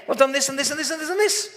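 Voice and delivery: fast, high rundown of items; rattled-off list. Retold or imitated. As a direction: tick them off quickly.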